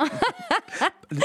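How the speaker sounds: a quick high burst of laughter; short laugh. Real, not acted. Guest laughing at her lockdown hobby joke, short high laugh. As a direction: laugh lightly at your own joke. Amused at her own remark.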